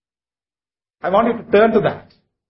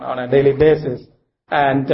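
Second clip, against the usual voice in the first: normal talking voice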